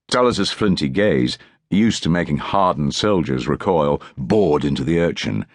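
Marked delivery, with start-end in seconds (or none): none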